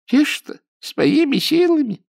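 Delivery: put-on high, slow old granny voice; old woman protesting. A narrator's reading. Baba Dunya protests to Ninka in her high old voice. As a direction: feign innocence.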